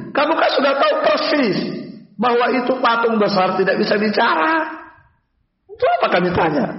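high-pitched, exasperated objection; voicing indignant people arguing back; acted protest. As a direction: protest as if the answer is obvious. Idol worshippers protest to Ibrahim.

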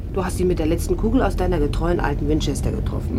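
normal talking voice

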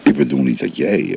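usual speaking voice

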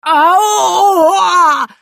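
high, loud pained wail; howl of pain. Acted. Priest struck with a poker, loud high howl. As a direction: yelp like you've been whacked. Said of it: "завопил он".

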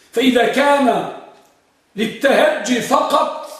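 slow and loud, pressing on للتهجي فقط; emphatic. Shown as a demonstration. Teacher hammering the key point to students, slow and loud. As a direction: drive home فقط, stretching every word. Teacher stressing the Kufan argument.

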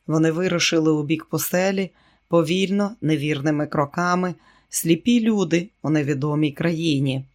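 ordinary delivery, nothing marked

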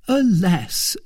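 a touch of high, loud woe; lightly acted mournful servant; sighed lament. A narrator's reading. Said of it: "sighed Bazin".

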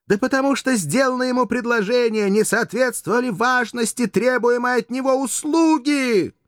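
loud, theatrical indignation; scornful tirade. A narrator's reading. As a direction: berate him as a poor fool. Courtesan scolds the poor fool.

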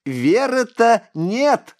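put-on very high, slow taunting child; eerie child voice. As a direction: stretch it out, sweetly cruel. Boy taunting, laughing.

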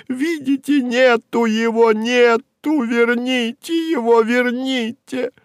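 high, drawn-out distraught wail; anguished cry. Acted. Secretary showing the accountant her vanished boss, anguished cry. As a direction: plead in a very high, slowing voice. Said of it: "вскричала".

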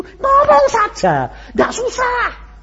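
high, loud, slow cry of ngomong saja; preaching shout. Acted. Preacher urging the congregation to just speak, shouting. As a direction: shout the exhortation up.

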